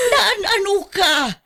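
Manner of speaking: alarmed mother. Acted. Mother sees daughter's bruises.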